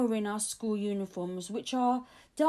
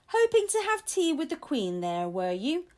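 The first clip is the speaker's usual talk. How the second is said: teasing; 6 semitones higher, 3 dB louder than usual. A narrator's reading. Guard teasing child.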